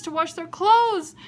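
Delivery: ordinary delivery, nothing marked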